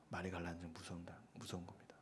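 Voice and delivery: whispered warning. Real, not acted. Pastor warning the congregation, hushed whisper.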